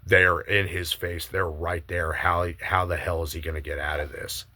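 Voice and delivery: ordinary talk, nothing marked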